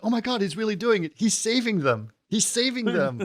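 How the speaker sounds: excited narrator. Retold or imitated. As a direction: gush it like an overexcited onlooker.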